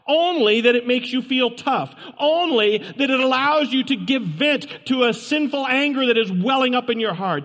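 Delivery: fiery pulpit appeal; preaching at full force. Acted. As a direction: press the question on the congregation. Preaching rhetorical challenge.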